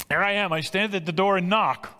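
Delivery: high, ringing call at the door; proclaiming. Acted. Preacher voices Jesus knocking at the church door, proclaiming. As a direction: proclaim it from the pulpit, pitched high.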